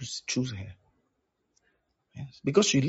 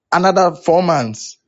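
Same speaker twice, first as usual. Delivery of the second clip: loud, raised-pitch insistence; emphatic demand; 5 semitones higher, 9 dB louder than usual. Acted. Pastor raising the prayer term for a petitioner, emphatic demand. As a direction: push the demand up, loud and high.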